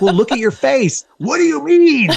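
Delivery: hammed-up incredulous wife; exasperated ex-wife. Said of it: "She's like".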